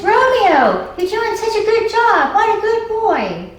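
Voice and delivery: very high pitched, sing-song praise. Shown as a demonstration. Trainer demos high happy voice for praising the dog. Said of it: "really, really high pitched".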